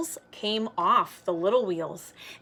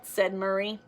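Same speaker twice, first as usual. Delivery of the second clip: ordinary delivery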